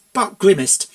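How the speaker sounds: usual speaking voice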